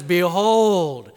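loud, long-held proclamation; ringing announcement with a stretched vowel; proclaiming. Acted. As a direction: present him to a crowd, loudly. Pilate presents Jesus to crowd.

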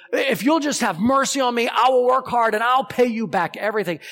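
high, loud begging cry; a frantic debtor shouting for mercy; desperate pleading shout. Acted. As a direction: beg the master at the top of your voice.

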